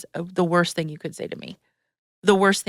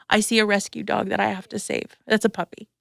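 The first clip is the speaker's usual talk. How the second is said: usual speaking voice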